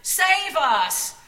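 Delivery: loud, high joyful cry; crowd shouting. A narrator's reading. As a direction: call it out like a cheering crowd. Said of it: "surrounded by people shouting".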